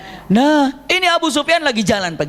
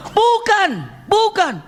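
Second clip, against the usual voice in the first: protesting shout; 12 semitones higher, much slower than usual. Acted. Abbas denies betraying him, high protesting shout. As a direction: cry out no, no.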